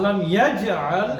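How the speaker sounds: slow recited Arabic, rising at the end; drawn-out আলাম ইয়াজআল; slow recitation. Shown as a demonstration. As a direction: recite it slowly to show the mid-word bounce. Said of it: "এটা হচ্ছে মাঝখানে".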